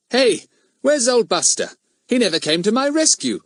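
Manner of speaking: high, calling schoolboy voice; suddenly notice and complain; surprised indignation. Acted. Boy notices his dog is missing.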